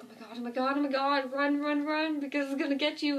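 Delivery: rising, urgent frantic warning; retold panic. Viewer reliving the urge to warn him of a walker, frantic. Relives urging character to run.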